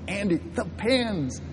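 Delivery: soft, slow stage whisper; furtive whisper. Acted. Preacher repeating to Andy who didn't hear, slow whisper. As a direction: hiss it slowly, trying not to be overheard. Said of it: "He didn't hear me. He came closer".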